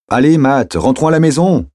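high, urgent call to a friend; shouted across a distance. Acted. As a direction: call him over, raising pitch and volume. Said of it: "cria-t-elle en le rejoignant".

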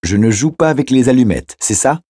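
put-on high-pitched small child, seeking confirmation; child's high, questioning voice; slightly worried little girl. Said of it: "Un peu inquiète, la petite fille".

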